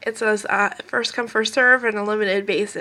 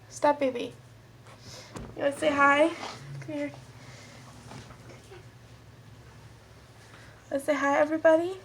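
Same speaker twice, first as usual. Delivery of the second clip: higher pet-talk voice; talking to a puppy; 5 semitones higher, 4 dB quieter than usual. Real, not acted. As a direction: coo at the dog, pitched up. Talking sweetly to her puppy.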